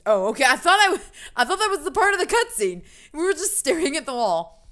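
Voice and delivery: loud, high protest at a mix-up; exasperated surprise. Real, not acted. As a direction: blurt it out in loud, high disbelief. Reacts to missed cutscene end.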